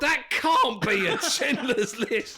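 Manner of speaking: laughing, slow, incredulous shout; really aghast but laughing; appalled disbelief. Real, not acted. Co-host reacting to a Schindler's List joke, appalled disbelief.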